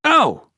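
sharp high yelp; yelp of pain. Acted. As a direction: yelp as if struck. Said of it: "yelped Mister de Bellarmine".

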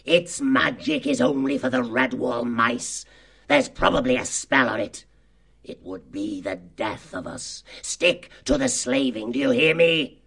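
furious fox chief laying down the law; savage temper. Acted. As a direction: warn the gang off angrily. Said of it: "furiously with savage temper".

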